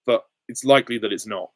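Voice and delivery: ordinary talk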